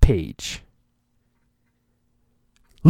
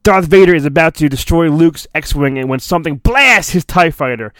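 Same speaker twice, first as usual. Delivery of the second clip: ordinary delivery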